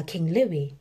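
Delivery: plain reading voice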